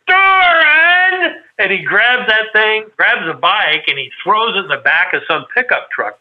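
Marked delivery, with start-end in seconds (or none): 0.1-1.3 loud, high, drawn-out yell of a name; furious yell. Retold or imitated. Owner finds thousands of miles on his bike, yelling loud and high. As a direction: bellow the name in outrage. Big Red sees the mileage.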